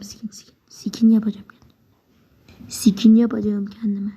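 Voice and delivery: slow whispered self-talk while choosing a skin; whispered to himself. Real, not acted. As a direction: breathe the words, barely voiced, to yourself.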